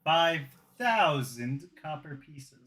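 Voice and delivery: slow deadpan. Acted. Joke price, drawn out.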